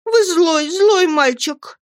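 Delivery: put-on high, drawn-out childish reproach; pouting young wife. A narrator's reading. Dora turns away from her husband, high pouting reproach. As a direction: pout it high, stretching the last words. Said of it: "и всё повторяла".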